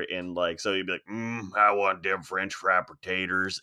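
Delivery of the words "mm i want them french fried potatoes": slow it right down like the Sling Blade character. Retold or imitated. Podcaster imitating actor in character in a car, slow Southern drawl. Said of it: "drive around in a car together in character".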